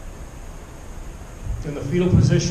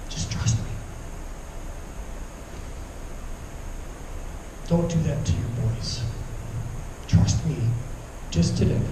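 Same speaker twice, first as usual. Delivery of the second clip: softer, hushed voice of God; 6 semitones lower, 11 dB quieter than usual. Acted. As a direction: breathe it gently, like a quiet answer. God's voice answering him.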